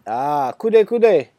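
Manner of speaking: put-on slow, weak Jamaican accent; a bad stab at Jamaican. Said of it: "the worst Jamaican accent you've ever heard".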